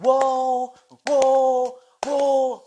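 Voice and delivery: very high, very loud repeated wows; overdone wows. Retold or imitated. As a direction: gush over and over, too much. Audience overdoes it each line.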